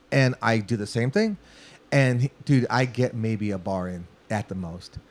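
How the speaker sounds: ordinary delivery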